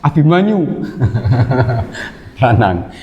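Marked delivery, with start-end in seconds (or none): 0.7-2.1 low run of chuckles. Real, not acted. Teacher amused during class, low chuckle. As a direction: chuckle to yourself in short low pulses.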